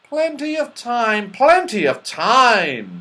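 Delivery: high start, slowing and dropping on the repeat; breezy dismissal. A narrator's reading. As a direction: wave the worry away.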